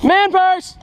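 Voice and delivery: excited shout. Real, not acted. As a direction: yell it out high. Shouts the phrase.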